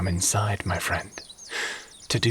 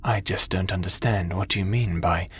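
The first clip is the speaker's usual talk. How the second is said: ordinary talk, nothing marked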